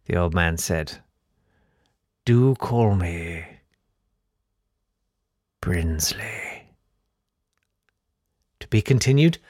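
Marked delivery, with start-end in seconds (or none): 2.2-6.6 very slow, sinking to a whisper; creepy old Count. Acted. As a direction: draw out the reveal, then whisper the name. The Count reveals his name.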